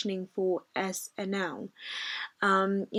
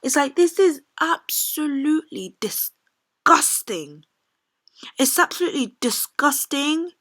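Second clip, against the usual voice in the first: disgusted anger; 7 semitones higher, 7 dB louder than usual. Real, not acted. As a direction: spit out the disgust. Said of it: "I'm so angry".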